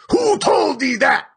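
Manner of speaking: high, loud challenging shout; cried out. A narrator's reading. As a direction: fire the question back at him. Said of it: "cried Ahab".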